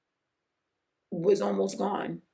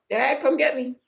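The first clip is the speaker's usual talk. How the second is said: put-on mild childlike plea; 5 semitones higher, 5 dB louder than usual. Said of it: "I'm crying".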